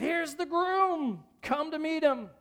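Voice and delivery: high, drawn-out call; shouted announcement. Acted. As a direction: call out the groom's arrival. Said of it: "someone shouted".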